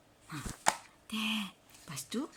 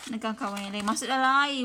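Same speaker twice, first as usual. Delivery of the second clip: ordinary delivery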